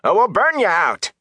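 high, angry shout of menace; shouted threat. A narrator's reading. Farmer with torch threatening to burn her out, shouting. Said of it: "he shouted".

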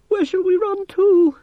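very high, loud, frightened question; panicky squeal. A narrator's reading. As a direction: squeal it in alarm. Panicking under the falling ship.

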